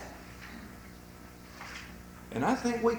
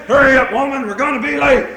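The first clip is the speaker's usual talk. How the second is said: loud mock holler at the wife; impatient husband's yell; 5 semitones higher, 11 dB louder, faster than usual. Husband honking for his wife, impatient yell. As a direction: imitate a husband yelling to rush her. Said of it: "now blows the car horn".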